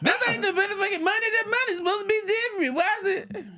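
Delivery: very high-pitched comic tirade; retold outrage. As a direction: squeal it out in hammed-up indignation. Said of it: "I was pissed".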